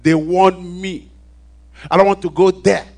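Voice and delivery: a man recounting his shout from the edge of hell; retold desperate shout. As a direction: beg for another chance at the top of the voice. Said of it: "And I was shouting".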